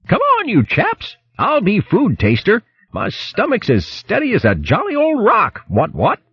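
put-on high-pitched, hearty old-chap hare voice; jolly hare character. Hare urging his crewmates ashore to the food, high jolly voice. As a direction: pitch it way up, jovial and blustering.

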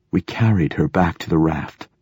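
ordinary delivery, nothing marked

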